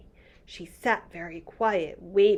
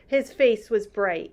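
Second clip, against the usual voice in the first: ordinary delivery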